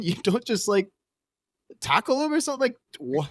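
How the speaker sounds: high-pitched disbelief, voice jumping up; can't believe what you're hearing, pitch up high; incredulous. Real, not acted. Incredulous rant about robbery.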